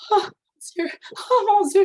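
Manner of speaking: high, loud panicked oh my God; panicked cry. Retold or imitated. Patient on the operating table panicking at the nurse, high frightened cry. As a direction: gasp it out in rising panic.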